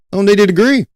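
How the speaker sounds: high-pitched, rising at the end; incredulous echo. Real, not acted. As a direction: toss it up high like a disbelieving question. Incredulous echo of his own claim.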